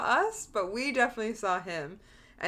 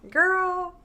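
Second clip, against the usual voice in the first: put-on a higher, louder, drawn-out "Girl"; scolding herself; 9 semitones higher, 5 dB louder than usual. Judging her old middle-school self, a high disbelieving "Girl". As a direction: disbelief at your past self. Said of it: "I did things that I'm like".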